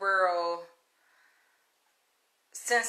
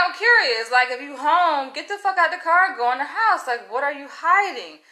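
higher, louder, insistent retold demand; heated questioning; 5 semitones higher, 5 dB louder than usual. Woman retelling how she challenged the man in his car, heated and insistent. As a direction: press him like you're calling out a lie.

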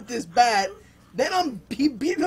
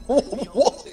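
normal talking voice

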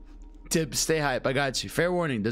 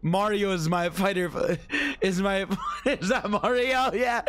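normal talking voice

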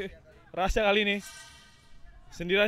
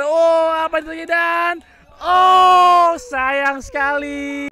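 excited shout; 6 semitones higher, 6 dB louder, slower than usual. Real, not acted. Near miss, commentator shouts.

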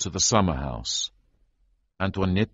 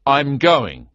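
louder, higher firm announcement; 8 semitones higher, 7 dB louder than usual. A narrator's reading. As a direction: state it, no argument. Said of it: "I announced firmly".

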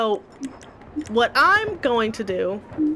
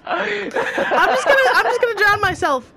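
laughing; 7 semitones higher than usual. Real, not acted. Laughing at own defeat.

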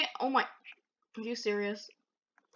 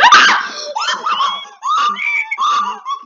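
high shrieking cackle of panic; laugh-scream as the ghost appears; 11 dB louder than usual. Real, not acted.

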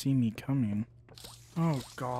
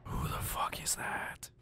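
scared whispered question; whispering; 8 dB quieter, slower than usual. Real, not acted. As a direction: whisper it, spooked. Hears strange voice in game.

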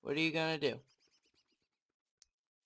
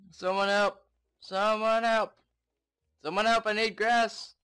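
loud, higher call for help; half-joking distress call, pitched up; mock cry for help; 5 semitones higher, 7 dB louder than usual. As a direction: holler out as if stranded, playfully. Calling out for help in game.

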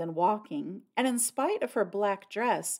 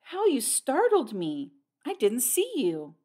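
startled; 4 semitones higher than usual. A narrator's reading. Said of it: "The girl started".

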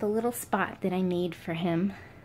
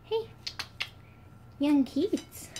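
pet talk; 8 semitones higher, much slower than usual. Real, not acted. Cooing at the pet.

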